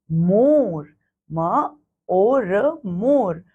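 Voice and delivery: मोर split as म ओ र, very slow; pronunciation drill. Shown as a demonstration. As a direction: sound out मोर letter by letter, very slowly. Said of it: "शब्दों का उच्चारण करते हैं".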